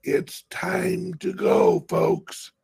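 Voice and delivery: high, slow, loud alarm call; shouted warning, drawn out; urgent shout. Real, not acted. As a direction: sound the alarm to the viewers. Urgent call to buy now.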